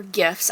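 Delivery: "gifs" with a hard g, as in "gift". Real, not acted. Correcting own slip, "gifs" with a hard g. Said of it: "I say gifs".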